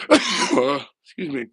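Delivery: slow, raspy voice after a cough. Real, not acted. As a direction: clear the throat and apologize slowly. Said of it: "then had to cough".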